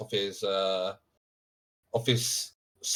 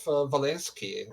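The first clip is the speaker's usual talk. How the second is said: ordinary talk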